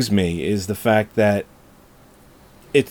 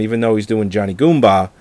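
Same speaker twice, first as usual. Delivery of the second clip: ordinary talk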